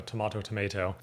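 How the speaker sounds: TOMATO as "to-MAH-to", then "to-MAY-to"; British, then American TOMATO; tomato both ways. Shown as a demonstration. Contrasting the two pronunciations.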